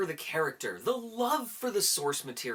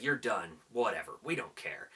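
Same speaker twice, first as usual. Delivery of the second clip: low, trailing write-off; retold shrug-off, dropping lower and softer; dismissive; 7 semitones lower, 3 dB quieter than usual. Movie fan mentally writing off Marvel, dismissive.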